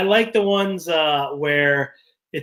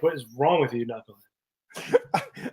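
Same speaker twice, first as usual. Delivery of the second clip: mock scolding; 3 semitones lower, 7 dB quieter, slower than usual. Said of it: "you can also be like".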